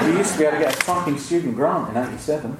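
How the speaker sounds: mock-outraged rant at the crowd; laughing indignation. Real, not acted. Telling students education should be free, laughing indignation.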